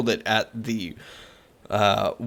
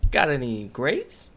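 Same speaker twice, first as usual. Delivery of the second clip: sing-song storybook voice, pitched up; lightly voiced joke-telling duck; mock storyteller; 8 semitones higher, 3 dB quieter than usual. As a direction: tell it like a children's tale.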